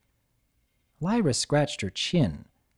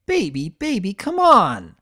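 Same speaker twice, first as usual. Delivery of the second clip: louder, higher begging; whining plea; 7 semitones higher, 5 dB louder than usual. A narrator's reading. Thunderlane begs Blossomforth to return, whiny and loud. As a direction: beg her to come back.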